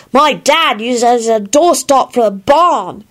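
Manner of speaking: put-on slow, high childish complaint; darkly grumbling child. A narrator's reading. Teddy tells Kingsley about the cake, darkly. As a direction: spell it out high like a disapproving kid. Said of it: "he added darkly".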